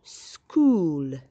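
school with a long drawn oo; modelled long oo. Shown as a demonstration. Teacher models school for young pupils, long oo. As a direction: stretch the oo in school for learners. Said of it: "makes a oo sound".

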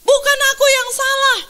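high, indignant mock protest; defiant protest. Preacher acts out refusing advice, defiantly protesting. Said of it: "aku langsung berontak".

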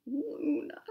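high, forlorn little answer; lightly acted tearful reply; sniffly. A narrator's reading. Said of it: "the moon sniffled".

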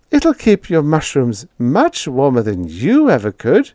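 proud landlady. A narrator's reading. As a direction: show off your gift.

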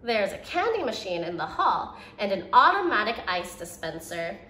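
ordinary delivery

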